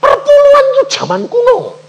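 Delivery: high, loud, fast scoffing dad; hammed-up father mocking tithing, high and loud; dismissive father. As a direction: brush off the son with a scoff. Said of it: "Papa bilang apa?".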